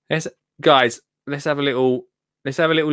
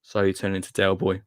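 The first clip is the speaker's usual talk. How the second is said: ordinary talk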